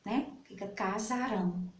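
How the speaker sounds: ordinary talk, nothing marked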